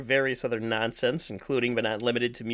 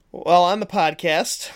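announcer voice; 5 semitones higher, 6 dB louder, slower than usual. Acted. Podcast intro announcer opening.

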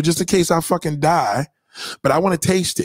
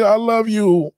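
mock tender; 9 semitones higher, 3 dB louder, slower than usual. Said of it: "I said".

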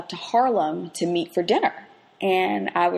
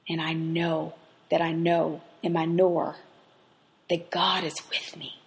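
hushed inner knowing; 3 semitones lower, 4 dB quieter than usual. Acted.

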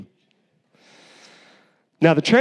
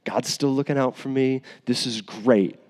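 soft, hushed, self-satisfied muttering; a lightly voiced Jonah, pleased with his shade; smug Jonah; 5 semitones lower, 7 dB quieter than usual. Jonah enjoying his shade, hushed and smug. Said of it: "It's like".

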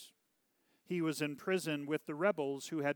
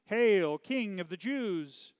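raised, drawn-out mock salute; mocking hail; 5 semitones higher, 7 dB louder, slower than usual. A narrator's reading. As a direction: hail him loudly, then stretch the title. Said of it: "mocked him, saying".

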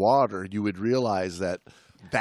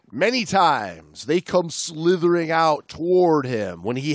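steady read-aloud narration; book reading; 3 semitones higher, 4 dB louder than usual. Acted. Podcast host reading a diving memoir aloud, narrating. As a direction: read the passage to the listener.

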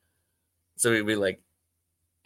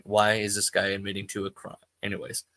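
ordinary talk

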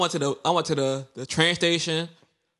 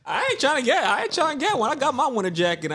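high excited denial; 10 semitones higher than usual. Real, not acted. Teasing denial, much higher.

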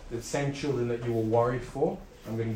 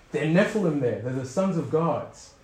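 alarmed crowd; 4 semitones higher, 6 dB louder than usual. Retold or imitated. Frightened people quoted.

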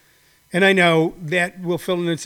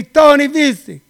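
friend Giuseppe in a hammed-up Italian-American accent, louder; mock Italian friend; 2 semitones higher, 4 dB louder than usual. Friend Giuseppe asks Tony why he talks like that, hammed-up Italian accent. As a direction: scold Tony for faking the accent. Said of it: "and he say".

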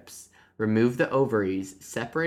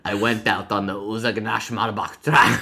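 put-on Russian accent, reading a confession; 4 dB louder than usual. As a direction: attempt a Russian hunter's accent. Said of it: "how to do a Russian accent".

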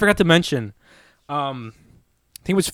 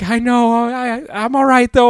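higher, put-on voice of the lady insisting she's okay; mimicking the woman; 10 semitones higher than usual. Woman reassuring the driver while burning, high mock voice. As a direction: squeak out her reassurance. Still imitating the burned woman.

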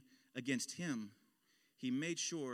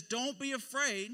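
slow, high call across distance; 10 semitones higher, 4 dB louder, slower than usual. Acted. Jesus calls to disciples across the stormy water, calling out. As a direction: draw it out slowly, pitched well up.

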